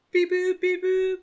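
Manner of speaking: high chirpy beep boop imitation; timer beeps. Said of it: "it's gonna, like".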